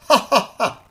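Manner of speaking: loud, high scornful ha ha ha; mocking laugh. A narrator's reading. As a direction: laugh in their faces. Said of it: "loud he shouted with reproach".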